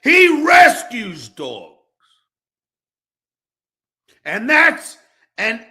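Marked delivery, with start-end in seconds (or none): 0.0-1.8 slow, shouted, every word hammered; retold defence. Retelling defending Rob to the haters, slow shout. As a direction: bellow it like you are defending him again. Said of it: "I said".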